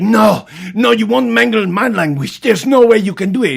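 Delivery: high, loud squawking retort; shrill argument. Retold or imitated. Teacher shouting back in the knife fight, shrill. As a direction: shriek it back at him.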